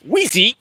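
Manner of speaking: high chirpy bird call, badly done; bird call impression. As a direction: chirp it like a tiny gray bird. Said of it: "that was a horrible impression".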